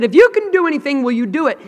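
high, fast, pleading father; a father begging Jesus, high and fast; desperate father. Retold or imitated. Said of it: "the father asked Jesus, he said".

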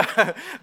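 brief chuckle at own joke; amused little laugh; short laugh. Real, not acted. Laughs at own joke about brother.

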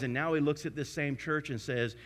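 ordinary talk, nothing marked

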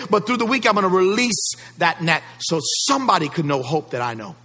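rally the church to release the net; full-force preaching. Acted. Pastor rousing his congregation, full-force preaching.

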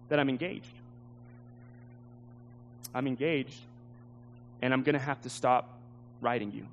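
low, quiet, halting bad news; reluctant confession. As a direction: break it gently, with heavy pauses. Woman breaking painful news by letter.

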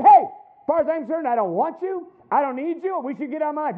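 loud, high, hammed-up fury; mock furious shouting; angry retort. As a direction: blast him out of your life. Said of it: "I turned and said".

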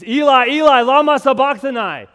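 very loud, high cry of anguish; Jesus cries out to the Father, screaming in agony. Acted. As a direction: cry out forsaken to the heavens. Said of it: "he screams".

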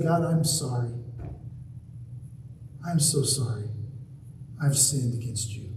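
low, soft apology with long pauses; contrite prayer. Acted. Preacher acting out a prayer to God, low contrite apology. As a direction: confess quietly and humbly, pitch dropped. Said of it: "I go to God and say".

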